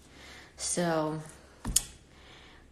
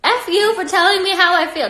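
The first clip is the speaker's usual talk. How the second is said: much higher and louder, real frustrated snap; angry outburst; 11 semitones higher, 11 dB louder than usual. Real, not acted. Snapping at a commenter who called her sad, angry. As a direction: let the irritation burst out.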